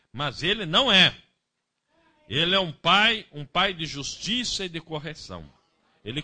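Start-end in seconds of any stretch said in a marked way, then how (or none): 0.1-1.1 não punched loud and falling; stress on não. Real, not acted. Preacher correcting the congregation, loud stress on "não". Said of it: "Vê você".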